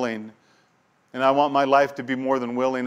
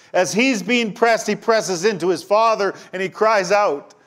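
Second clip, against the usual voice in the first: higher and louder, pressing each phrase; impassioned preaching; 8 semitones higher, 5 dB louder than usual. Acted. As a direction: preach with climbing urgency, slowing on the press. Preaching Jesus in agony.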